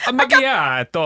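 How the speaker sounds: slow mock Italian "A macchiato". Joining the joke, a mock Italian "A macchiato". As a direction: play the Italian barista, lightly. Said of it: "He could be like".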